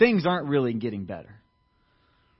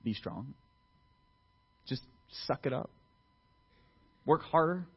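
soft, flat, curt paraphrase; dismissive paraphrase; 2 semitones lower, 7 dB quieter than usual. Retold or imitated. Preacher mocking a harsh reading of God, curt. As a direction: toss it off like a cold order. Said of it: "God's message is".

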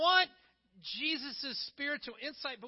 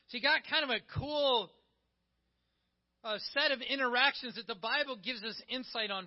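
ordinary talk, nothing marked

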